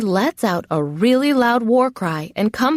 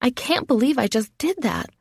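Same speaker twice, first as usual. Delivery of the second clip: ordinary delivery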